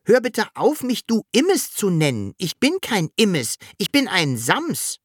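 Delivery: high-pitched protest; indignant call. A narrator's reading. Sams refusing to be called Immes, high indignant call. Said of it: "Das Sams rief".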